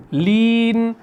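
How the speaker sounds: لین with a light thin ل; لین pronounced with a fine, light ل; thin lam. Shown as a demonstration. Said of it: "تو ہم اس کو کیسے پڑھ رہے ہیں؟".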